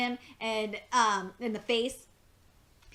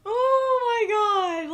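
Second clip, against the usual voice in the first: slow, high, laughing so hard it cracks; can barely get it out for laughing; real helpless laughter; 9 semitones higher, 7 dB louder, much slower than usual. Real, not acted. Reacting to Cheryl's joke, real helpless laughter.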